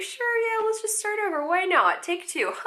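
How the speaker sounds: very high, strained chirpy voice; forced cheer. Retold or imitated. Actress covering her flop for the casting director, high and forced. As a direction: fake brightness while panicking. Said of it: "And I was like".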